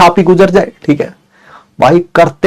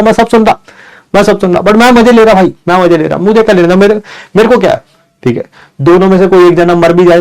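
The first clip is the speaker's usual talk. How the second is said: ordinary talk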